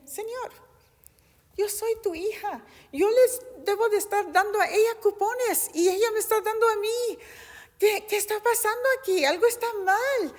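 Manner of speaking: high, tearful plea to the Lord; tearful pleading cry. Retold or imitated. A woman crying out to the Lord after receiving coupons, tearful and high-pitched. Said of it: "me solté llorando".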